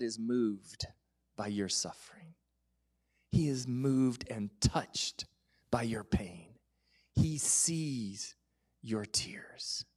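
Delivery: hushed intimate whisper. Real, not acted. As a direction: lean in and breathe the comfort. Intimate assurance about God's care.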